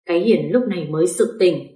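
ordinary delivery, nothing marked

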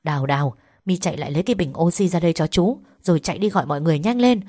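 rapid-fire instructions; hurried, very quick commanding; urgent orders. Acted. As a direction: rattle off orders in an emergency. Uncle gives emergency orders.

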